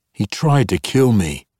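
high, loud indignation; indignant. Acted. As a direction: protest hotly. Zaknafein indignant.

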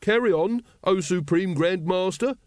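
high, slow, fawning urging; deferential. A narrator's reading. Brother Watchtower invites Grand Master to continue, deferential. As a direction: bid the leader continue with ceremony. Watchtower obsequiously urges leader on.

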